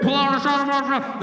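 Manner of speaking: high, muddled loudspeaker imitation; garbled police loudspeaker. Irritated cop over car speaker, garbled. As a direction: slur it into an unintelligible tannoy order. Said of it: "couldn't hear what the hell he was saying".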